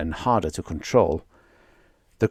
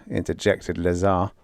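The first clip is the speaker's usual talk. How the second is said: ordinary delivery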